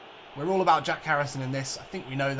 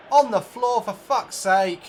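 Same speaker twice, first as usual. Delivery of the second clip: higher, louder, slowed curse; exasperated curse; 6 semitones higher, 9 dB louder, slower than usual. Real, not acted. Gamer cursing a foul on his player, exasperated. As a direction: drag out the exasperation.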